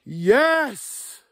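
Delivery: high, loud whoop of joy; real victory yell; triumphant yell. Real, not acted. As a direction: shout it out, he finally found one. Finds his first moose paddle.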